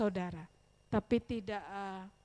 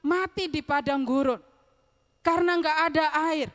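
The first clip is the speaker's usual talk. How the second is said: loud, higher-pitched angry complaint; protes marah; 6 semitones higher, 7 dB louder than usual. Retold or imitated. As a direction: cry out in indignant protest as the fed-up crowd. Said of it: "mereka marah". (protes marah dengan suara keras dan tinggi)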